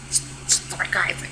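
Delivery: unvoiced whisper of a joke; whispered aside. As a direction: whisper it to the crowd.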